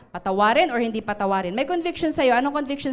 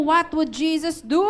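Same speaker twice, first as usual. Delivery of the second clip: raised and louder prompt; urging louder repeat; 5 semitones higher, 4 dB louder than usual. Shown as a demonstration. Pastor urges the congregation to repeat it, louder. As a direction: push them to ask it aloud. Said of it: "Sige, tanungin mo".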